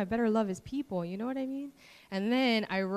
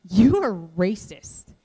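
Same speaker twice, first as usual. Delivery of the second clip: blunt accusation; 12 dB louder, slower than usual. Retold or imitated. Friend confronts her bluntly.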